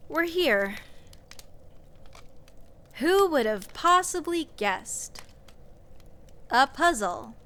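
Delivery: mock the obvious puzzle; deadpan scorn at the cliché; sarcastic. Mocking predictable dungeon puzzle.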